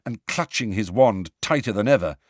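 ordinary delivery

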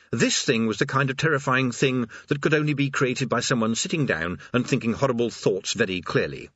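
ordinary delivery

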